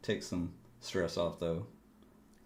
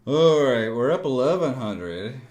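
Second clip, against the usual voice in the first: much louder, triumphant; loud announcement; 4 semitones higher, 11 dB louder than usual. Real, not acted. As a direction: announce the win proudly. Announcing big profit.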